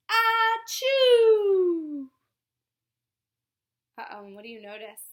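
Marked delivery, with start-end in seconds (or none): 0.1-2.1 loud high achoo; big sneeze. Acted. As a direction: let out a huge sneeze. Flea sneezes in story.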